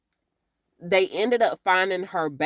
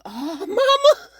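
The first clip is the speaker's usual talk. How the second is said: shocked oh mama; 10 semitones higher, 4 dB louder than usual. Real, not acted. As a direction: gasp it out high and scandalised. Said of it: "So inappropriate".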